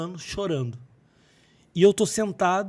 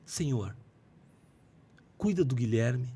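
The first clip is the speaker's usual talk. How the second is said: put-on low, soft prayer over a child; retold prayer; 5 semitones lower, 5 dB quieter than usual. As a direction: pray quietly and slightly lower, reenacting the moment. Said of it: "coloquei a mão n-, assim no peito dele e falei assim". (oração em voz baixa)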